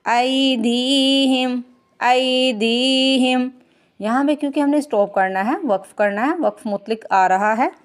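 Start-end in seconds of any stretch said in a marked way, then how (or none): 0.1-3.5 ऐदीहिम with the दी drawn long; long dal. Shown as a demonstration. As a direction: stretch दी in ऐदीहिम. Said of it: "दाल को खींच के अदा करेंगे". (ऐदीहिम में दाल को खींच कर)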